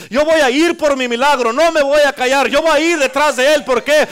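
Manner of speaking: loud, high, driving sermon delivery; building pulpit intensity; full-force preaching. Acted. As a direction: preach it with rising fire.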